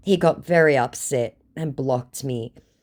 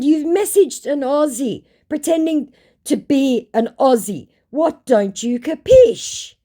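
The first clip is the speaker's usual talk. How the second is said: higher and louder, slow on the last line; mocking scorn; 13 semitones higher, 6 dB louder than usual. Aussie woman taunting a scammer, mocking scorn. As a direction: taunt him, drag out capish.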